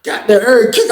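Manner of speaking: high laughing outburst; laughing hard. Real, not acted. Laughing while retelling how hard Luke kicked. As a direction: crack up while telling it.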